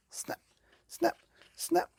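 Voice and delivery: high snapping jaws; sharp snap snap sound effect. A narrator's reading. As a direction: snap the words like a crocodile.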